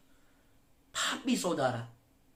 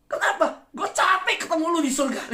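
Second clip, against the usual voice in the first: louder and much higher, laughing through it; loud laughing retort; 11 semitones higher, 6 dB louder than usual. Retold or imitated. Neighbour snapping at the evangelist, loud and laughing. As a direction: blurt it out cracking up. Punchline retold in Jakarta slang.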